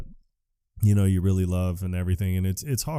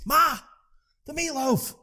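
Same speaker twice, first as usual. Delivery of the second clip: high-pitched mock call to Mom; hammed-up, high calling out; demanding man-child. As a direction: whine for dinner from another room, up high. Imitating man yelling for his mother.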